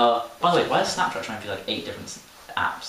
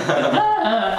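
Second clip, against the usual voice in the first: laughing yelp; 11 semitones higher, 4 dB louder than usual. Retold or imitated. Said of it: "It's just like".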